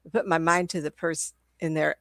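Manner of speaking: usual speaking voice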